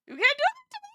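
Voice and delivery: crying. Real, not acted. Streamer pleading with Amber while crying, voice breaking. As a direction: squeeze the words out through tears. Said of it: "I have tears coming out of my eyes".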